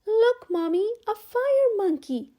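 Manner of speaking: put-on high, loud excited child voice; little girl showing her mother; delighted child. A narrator's reading. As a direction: squeal it with delight. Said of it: "laughed and said".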